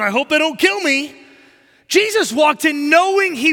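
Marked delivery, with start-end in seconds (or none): 0.0-1.1 mock fearful. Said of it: "Jesus did not walk into Jerusalem going".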